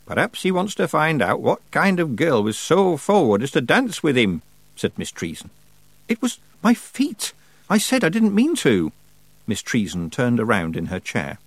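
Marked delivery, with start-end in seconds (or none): 0.1-4.4 high, pointed character voice; needling old witch. A narrator's reading. Miss Treason's character voice. 6.1-8.9 high-pitched, plaintive excuse; girl excusing herself in a high voice; defensive protest. A narrator's reading.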